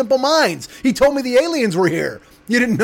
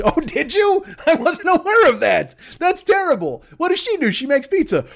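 high, prissy put-on voice; mock shocked gossip; 8 semitones higher than usual. Busybody hears about Mama Celeste, high mock shock. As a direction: gasp it out like a scandalised busybody.

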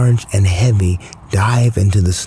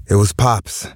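plain reading voice

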